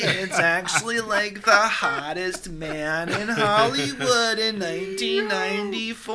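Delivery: high, loud, hugely drawn-out protest; hammed-up indignant millennial. Imitating millennials correcting Gen Z about Pauly Shore, mock outrage. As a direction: stretch every word in mock outrage. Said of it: "millennials are like".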